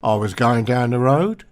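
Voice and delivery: rising questioning tune on "road"; statement ending like a question. Shown as a demonstration. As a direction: lift "road" upward as if asking. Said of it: "ending statements as if they were questions".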